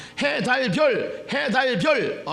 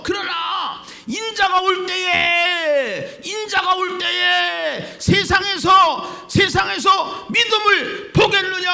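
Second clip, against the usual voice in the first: high, loud, drawn-out shouts; full-force preaching; 13 semitones higher, 7 dB louder, slower than usual. Real, not acted. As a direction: cry the verse out, holding each phrase end. Preacher proclaims verse at full force.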